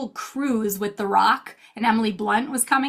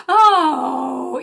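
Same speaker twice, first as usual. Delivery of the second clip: put-on cooing at a baby; 5 semitones higher, 6 dB louder than usual. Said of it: "all of these people on Zoom go".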